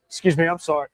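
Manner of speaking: normal talking voice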